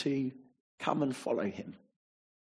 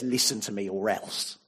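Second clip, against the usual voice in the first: threatening; 6 semitones lower than usual. Retold or imitated. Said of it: "God is exasperated with you".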